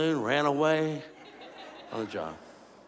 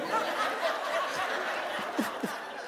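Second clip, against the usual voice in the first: high silent wheezing laugh; breathless laugh; 12 semitones higher, 10 dB quieter than usual. Real, not acted. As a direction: laugh until the voice cuts out. Balloon mishap on stage.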